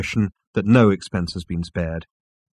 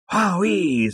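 excited; 9 semitones higher than usual. A narrator's reading. Zaphod exclaims in audiobook.

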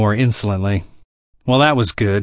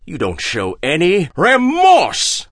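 higher, slow, outraged scolding; overriding outrage; 5 semitones higher, slower than usual. A narrator's reading. Hagbard overriding Harry, slow higher outrage. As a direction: run right over him. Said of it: "Hagbard ran over him".

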